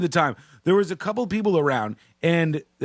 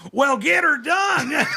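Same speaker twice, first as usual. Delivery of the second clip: high, slow, hammed-up shouted catchphrase; Larry the Cable Guy shout; 10 semitones higher, slower than usual. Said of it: "and you're like".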